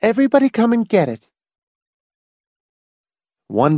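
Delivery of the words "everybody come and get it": quick, high-pitched call to the table; sharp call. A narrator's reading. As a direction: call out briskly from another room. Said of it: "called sharply from the living room".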